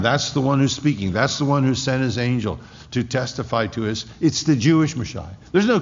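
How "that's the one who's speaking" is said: usual speaking voice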